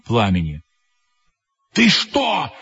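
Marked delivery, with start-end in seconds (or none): 1.7-2.5 hissing reproach. A narrator's reading. One head scolding another over a secret, hissing reproach. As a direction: scold as an indignant storybook monster. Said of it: "зашипела на синюю голову голубая".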